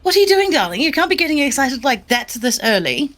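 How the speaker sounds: voiced high and bright as the fussing aunt-like Ophelia; high elegant lady voice. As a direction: tease in a high doting lady's voice. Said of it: "she says".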